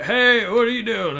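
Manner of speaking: high, slow neighborly holler at moderate volume; put-on voice calling across the yard; imitated calling out. Host imitates old neighbor calling to him, drawn out.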